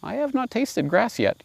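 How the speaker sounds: light high lamb voice; polite lamb. Acted. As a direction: answer meekly and politely. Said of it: "still very polite".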